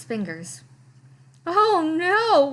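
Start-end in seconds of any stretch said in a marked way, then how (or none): 1.5-2.5 high, loud anguished wail. A narrator's reading. Lester wailing at the mountain of sweaters, loud. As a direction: cry it out in despair. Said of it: "wailed Lester".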